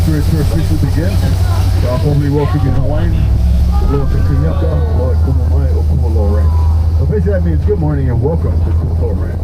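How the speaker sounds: loud, formal tour guide welcome; singsong puppet show host. Acted. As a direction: greet the group like a show host.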